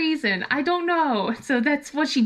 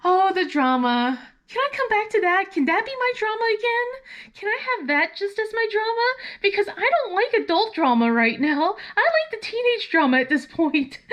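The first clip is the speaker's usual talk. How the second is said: higher, theatrical sigh of delight; mock-dramatic mom; 9 semitones higher than usual. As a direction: savour the teen drama playfully. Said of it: "I'm like".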